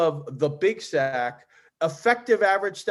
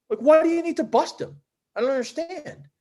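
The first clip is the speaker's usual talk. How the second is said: high-pitched retold disbelief; baffled challenge; 11 semitones higher than usual. Challenging a player's reason for calling, baffled. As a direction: question his logic with the pitch jumping up.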